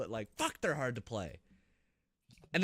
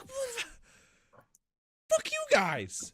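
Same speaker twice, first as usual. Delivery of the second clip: outraged squeak; much slower than usual. Retold or imitated. Player shocked the enemy built a helicopter, high squeaky outrage. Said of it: "I'm like".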